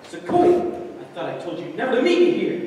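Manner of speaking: soap opera hero. Acted.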